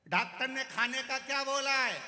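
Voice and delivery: shouted question. Retold or imitated. Deaf visitor shouts a question at the patient.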